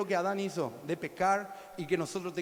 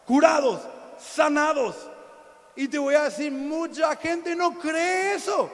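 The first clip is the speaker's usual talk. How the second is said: full-force preaching; 7 semitones higher, 8 dB louder than usual. Real, not acted.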